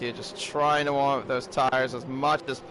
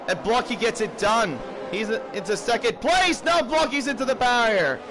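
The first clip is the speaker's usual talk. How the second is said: excited play-by-play; 6 semitones higher than usual. Acted. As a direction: shout the overtake as it happens. Live race commentary, overtaking.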